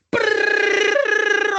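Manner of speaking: long high rolled "brrr"; imitated rattling noise. Pupils pelting the blackboard with marbles, imitated rattle. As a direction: trill it out like objects hitting a board.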